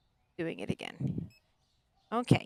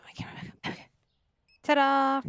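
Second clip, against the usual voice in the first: ordinary talk, nothing marked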